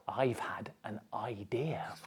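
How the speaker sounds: secretive unvoiced whisper. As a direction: confide it softly. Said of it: "the bark meant".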